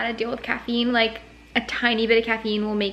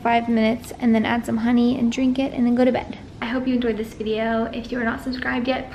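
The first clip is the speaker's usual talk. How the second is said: ordinary talk, nothing marked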